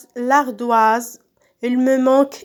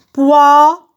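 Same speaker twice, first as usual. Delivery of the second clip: Poire loud and stretched, final RE set apart; syllable by syllable; 3 semitones higher, 8 dB louder than usual. Shown as a demonstration. Teacher cutting words into syllables, syllable by syllable. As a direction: punch out Poire as POI, pause, RE. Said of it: "je découpe les mots en syllabes".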